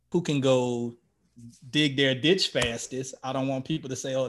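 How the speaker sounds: normal talking voice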